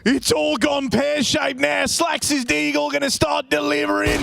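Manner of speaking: high, shouted esports call; shouting play-by-play. Acted. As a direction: yell the round home like a caster.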